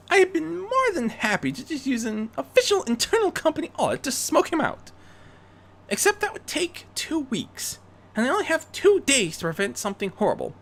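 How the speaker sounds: Rarity briefing. Acted. Rarity briefing Bruce on the weapons, high and urgent. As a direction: lay out the facts urgently.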